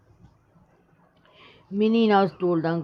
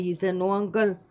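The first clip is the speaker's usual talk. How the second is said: ordinary delivery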